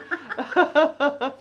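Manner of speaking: loud, high burst of laughter; hearty laugh. Real, not acted. Co-host cracks up at the toilet remark, hearty laugh.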